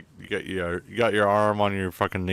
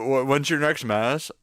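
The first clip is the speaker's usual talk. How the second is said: ordinary talk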